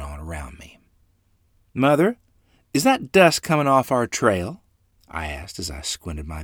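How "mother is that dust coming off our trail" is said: put-on high, loud little boy calling to his mother; a small child squinting, asking out loud; young boy voice. A narrator's reading. Little Orion asks Mother about the dust, high and loud.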